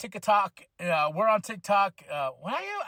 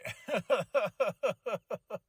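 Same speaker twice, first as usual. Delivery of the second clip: laughing; 3 semitones higher than usual. Real, not acted.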